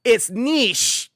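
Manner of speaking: high, loud mocking commenter voice; whiny know-it-all correcting you; sneering commenter insisting on niche. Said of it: "you know what I got in the comments".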